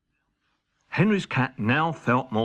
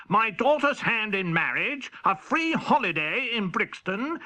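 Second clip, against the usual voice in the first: a hint of a high royal voice; lightly voiced king; 8 semitones higher than usual. King presents Henry's cat with prizes, lightly royal. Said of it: "who said".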